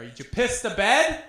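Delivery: high-pitched mock holler, climbing at the end; dad yelling. Dad taunts Craig about his mattress, high mock yelling. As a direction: imitate a dad broadcasting an embarrassing question. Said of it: "He was yelling it".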